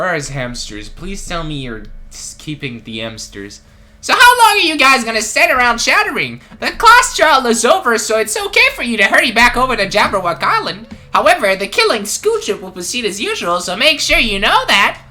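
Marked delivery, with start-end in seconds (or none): 4.0-15.0 high, loud, gleeful host voice; mascot announcer voice. Acted. Sinister mascot dismissing students after the class trial, loud and gleeful. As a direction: boom it out like a gleeful game host.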